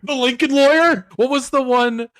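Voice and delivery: high, loud incredulous question; incredulous protest. Real, not acted. Co-host searching for a better McConaughey film, loudly incredulous. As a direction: pitch it way up in disbelief.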